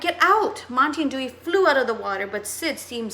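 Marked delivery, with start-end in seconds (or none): 0.0-0.7 much higher, louder shout; urgent cry. Acted. Boy shouting at friends to leave water, urgent yell. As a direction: yell for them to hurry out. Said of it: "Chet cried".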